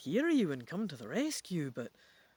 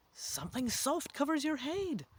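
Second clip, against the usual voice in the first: usual speaking voice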